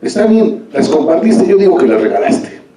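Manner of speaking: the king bristles, voice pushed up; angry king. Acted. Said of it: "el rey enfadado le dice".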